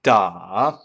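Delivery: "da" low dip then rise; dipping tone da. Shown as a demonstration. Teacher repeats third tone dip on da. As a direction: scoop "da" down and up. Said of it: "pitch goes down and then back up".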